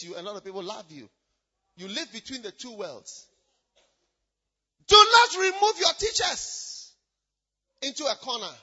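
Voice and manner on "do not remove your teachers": slow, high, emphatic pulpit shout; loud exhortation. Real, not acted. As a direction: call out a firm command, drawn out. Preacher exhorts the congregation.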